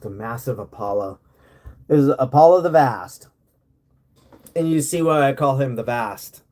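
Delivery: ordinary talk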